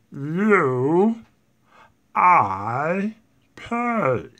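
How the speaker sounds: high, halting little-alien Yoda voice. Retold or imitated. Teacher doing Yoda for the class, high and halting. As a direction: do Yoda, words slow and jumbled. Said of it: "We talk like Yoda".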